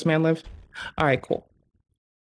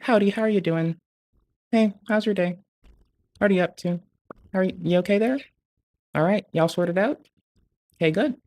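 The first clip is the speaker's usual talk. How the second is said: cheery phone greeting; 3 semitones higher than usual. Retold or imitated. Imitating himself answering phones at work, bright and friendly. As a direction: greet every caller like an old friend. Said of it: "always like".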